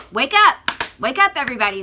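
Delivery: shouted loud and rising; wake-up call. Real, not acted. Vlogger shouting at her followers to wake up. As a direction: yell it at the whole country. Urging viewers against brainwashing.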